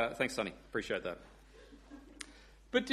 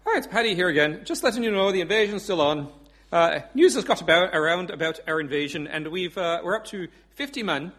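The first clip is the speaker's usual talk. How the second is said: put-on Irish accent; 3 dB louder than usual. Pub regular updating the dictator, punchy Irish accent. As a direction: announce it proudly in an Irish accent. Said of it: "I do do an Irish accent".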